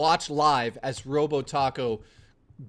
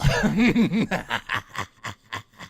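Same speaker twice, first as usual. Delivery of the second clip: evil cackle. Pretend. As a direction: laugh like a cartoon villain. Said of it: "I'm evil".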